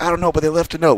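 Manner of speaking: high-pitched mock distressed voice; Wild Bill in a fluster. Wild Bill answering his boss, high flustered imitation. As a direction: imitate a flustered man protesting. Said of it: "he was just freaking out and cursing".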